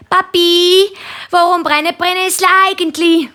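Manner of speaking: hohe, laut rufende Kinderstimme; löchere den Papi mit hoher Kinderstimme; fragendes Kind. A narrator's reading. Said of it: "stellt, äh, Nina und der Christoph ihrem Papi ständig Fragen". (hochi Chinderstimm, fröglet)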